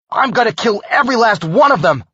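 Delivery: loud high vengeful shout; furious shout. Acted. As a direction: swear revenge at full voice. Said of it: "I shouted".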